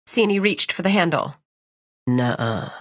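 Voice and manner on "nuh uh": low, taunting denial; menacing villain. Acted. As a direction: cut her off, low and smug. Villain stops her.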